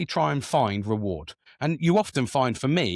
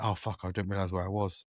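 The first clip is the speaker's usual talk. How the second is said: quiet realisation; 5 semitones lower, 8 dB quieter than usual. Retold or imitated. Realising he was too loud.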